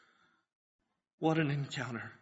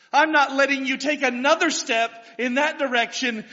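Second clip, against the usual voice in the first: raised, pleading voice, higher and louder; desperate plea; 7 semitones higher, 7 dB louder, faster than usual. Acted. Mother blocking Jesus' path, loud desperate plea. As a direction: beg him not to walk away.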